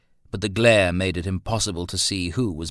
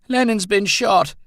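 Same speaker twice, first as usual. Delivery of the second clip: high, louder breathless alarm; gasping; 13 semitones higher, 7 dB louder than usual. A narrator's reading. Roland telling Harry the dog is hurt, gasping. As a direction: blurt it out, out of breath. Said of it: "Roland gasped".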